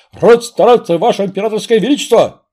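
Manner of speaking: громкий солдатский рявк; рявкнуть по-военному, бодро; громкий бравый солдат. Acted. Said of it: "рявкнул Пахом". (громко рявкнуть, как на плацу)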